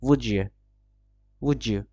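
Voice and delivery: "would you" merged into wu-jya, falling; "would you" as one word, wu-jyu; linked form. Shown as a demonstration. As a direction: run "would you" together with a j in the middle. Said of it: "হয়ে যাবে".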